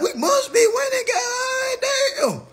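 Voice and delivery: high, crying-out triumph; gleeful yell. Retold or imitated. Boasting about beating Milk Dud, high gleeful yell. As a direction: wail it like a win. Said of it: "I was like".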